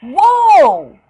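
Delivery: big high excited whoa; loud whoa. Real, not acted. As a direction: shout it as the plane dives. Plane battle exclamation.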